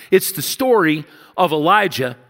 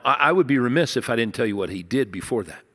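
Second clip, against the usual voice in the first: ordinary delivery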